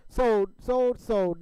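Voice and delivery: shouted sold, sold, sold; loud sold call. Acted. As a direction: bang down the final sale call. Auctioneer closing the sale.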